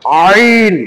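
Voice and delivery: আইন squeezed from mid-throat; throat-pressed ayn. Shown as a demonstration. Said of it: "চেপে উচ্চারণ করতে হয়".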